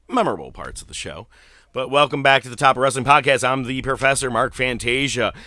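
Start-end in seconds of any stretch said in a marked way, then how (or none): 1.7-5.3 podcast host intro. Acted. Podcast welcome read.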